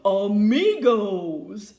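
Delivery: slow, loud, booming call; bellowed. A narrator's reading. Bullfrog rallying the band, slow booming bellow. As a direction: bellow it out slowly like a big bullfrog. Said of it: "bellowed the bullfrog".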